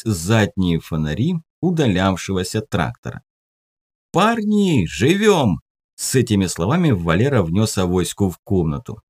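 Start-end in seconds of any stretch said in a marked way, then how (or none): 4.1-5.6 high gleeful call; excited. Acted. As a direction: cheer it out high. Drunk teen calling out gleefully.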